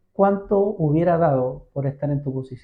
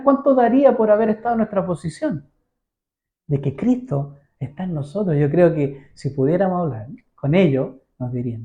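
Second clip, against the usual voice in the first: ordinary talk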